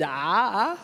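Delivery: lightly voiced editor, low and halting; hesitant, low-pitched hint of a sly editor; newspaper editor. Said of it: "The editor said".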